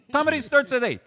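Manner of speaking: high, loud insistent shout; owner yelling. Retold or imitated. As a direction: drown out the whole room. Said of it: "he goes".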